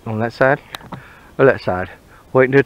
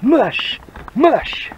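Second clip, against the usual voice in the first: dog sled call; 10 semitones higher than usual. Pretend.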